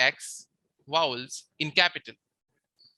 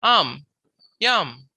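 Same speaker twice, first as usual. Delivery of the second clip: am, yam, each louder on the A; stress on A; 5 dB louder than usual. Shown as a demonstration. Teacher showing the marked vowel, yam stressed on A. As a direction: hit the A in am and yam. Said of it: "The accent is on".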